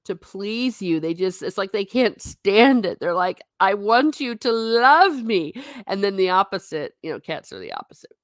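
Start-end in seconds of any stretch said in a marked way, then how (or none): 3.6-5.5 slow, pleading puppy voice, higher and louder; the dog begging its owner, a pleading puppy voice; eager dog voice. Pretend. 7.0-8.1 quick, secretive whispered aside; a hushed aside to the viewers about cats. Real, not acted.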